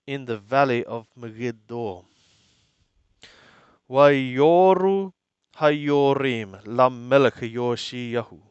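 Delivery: normal talking voice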